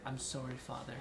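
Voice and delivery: retold timid reply, barely audible. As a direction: shrink the voice into a timid mumbled apology. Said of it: "I played it off so timid".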